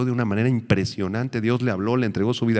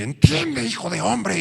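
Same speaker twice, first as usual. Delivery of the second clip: high, strained mock divine voice; a stern heavenly command, pitched up; stern God voice; 10 semitones higher, slower than usual. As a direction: play God scolding his servant. Imitating an angry God.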